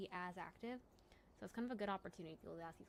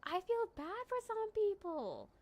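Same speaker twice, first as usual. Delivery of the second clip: high sympathetic; 11 semitones higher, 6 dB louder, slower than usual. Real, not acted. Sympathy after laugh.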